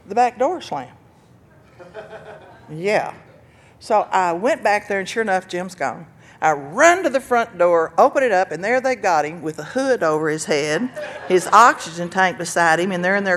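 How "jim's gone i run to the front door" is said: ordinary talk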